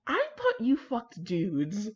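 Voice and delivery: slow, drawn-out baffled question; mock John, baffled. Reader voicing John, stunned by Sherlock's bride, slow and baffled. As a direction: stare him down and drag it out in disbelief. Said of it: "he'd look at him like".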